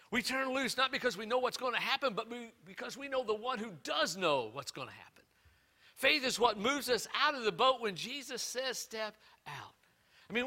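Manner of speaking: loud, high, fervent preaching; full-force preaching. Acted. Pastor preaching to his church, loud and fervent.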